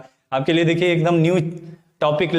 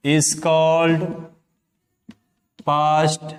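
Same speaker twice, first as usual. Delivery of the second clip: slow word-by-word dictation; much slower than usual. Shown as a demonstration. Teacher dictating a definition to students, one word at a time. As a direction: say each word alone with long pauses between.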